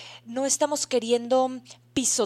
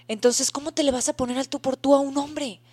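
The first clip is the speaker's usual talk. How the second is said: usual speaking voice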